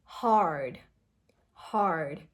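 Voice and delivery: "hard" drawn out, R strongly sounded; strong American R. Shown as a demonstration. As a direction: curl the tongue up through the R of "hard". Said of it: "a very strong ra sound".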